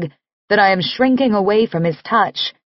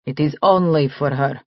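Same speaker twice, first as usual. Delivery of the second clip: put-on Russian accent; 5 semitones lower than usual. Nick speaks in Russian accent.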